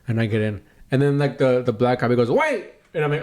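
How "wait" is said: high sharp call of wait; imitating the cop's sudden wait; sudden cop shout. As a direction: stop him dead with a yelp. Cop suddenly stops him.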